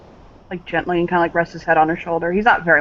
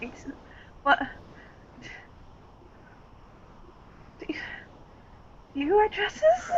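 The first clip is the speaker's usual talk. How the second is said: much higher, softer, disbelieving question; baffled; 13 semitones higher, 13 dB quieter than usual. Acted. Daughter asking her boyfriend, high and baffled. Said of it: "look up at him like".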